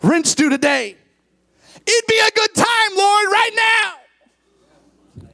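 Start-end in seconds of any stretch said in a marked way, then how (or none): 1.8-5.3 high, loud pleading cry to God; shouted plea. Acted. Preacher acting a broke believer begging God for rent, shouted. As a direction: yell it up to heaven, urgent.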